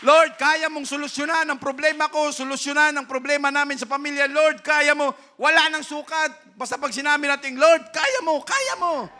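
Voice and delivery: loud, high pulpit voice; fiery preaching. Acted. Preacher rousing the brethren, loud and high. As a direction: drive the point home to the congregation.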